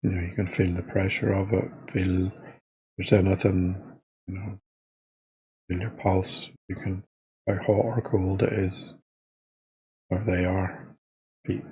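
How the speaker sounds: soft, unhurried body-scan guidance with long pauses; guided meditation. Real, not acted. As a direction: talk them gently into noticing each body part, quietly.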